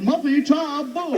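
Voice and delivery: high, slow ghostly call; yuppie ghost. Acted. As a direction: draw it out in a spooky high voice.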